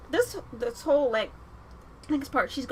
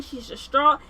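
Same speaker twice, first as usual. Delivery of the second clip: ordinary talk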